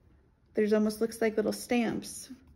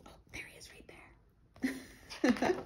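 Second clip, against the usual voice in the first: whisper; 4 semitones higher, 5 dB quieter, much slower than usual. Acted. Spotting the hidden bunny.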